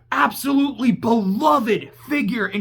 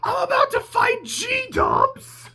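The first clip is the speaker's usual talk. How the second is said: high, cracking excited cry; giddy high-pitched fan excitement; hyped disbelief; 11 semitones higher, slower than usual. Retold or imitated. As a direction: squeal like a fan meeting a legend. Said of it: "I'm like".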